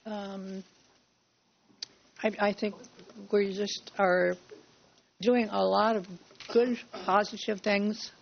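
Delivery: usual speaking voice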